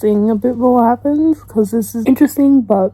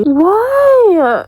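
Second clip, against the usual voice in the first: high, loud, drawn-out WHY; distraught cry of protest; anguished wail; 9 semitones higher, 5 dB louder than usual. Real, not acted. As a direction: wail the question in grief. Grieving a favourite character's death.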